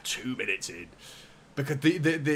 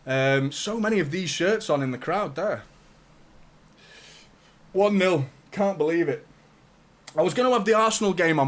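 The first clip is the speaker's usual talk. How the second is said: ordinary talk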